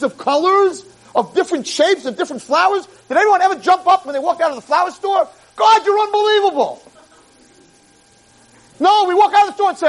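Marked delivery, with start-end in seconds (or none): 5.6-6.8 amazed praise. Pretend. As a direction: burst out in wonder. Imagined thrilled shopper.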